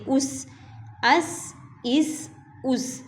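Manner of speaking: the س in اس hissed with a clear whistle; whistling s. Shown as a demonstration. Teacher showing the whistling seen to students. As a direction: draw out the hissing س in اس slowly. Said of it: "یہ سیٹی والے ہوں گے".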